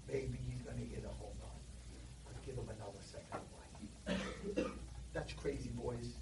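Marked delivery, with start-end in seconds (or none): 0.1-4.7 softer, lower, slow and breathy; gentle patience. Retold or imitated. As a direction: murmur it with patient mercy. God patiently waiting, softly.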